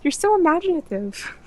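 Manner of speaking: high doting mother; proud mom voice. Retold or imitated. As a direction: gush like a mom. Continuing the mom impression.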